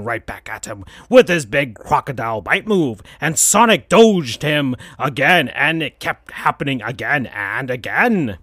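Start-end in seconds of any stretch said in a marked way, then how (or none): none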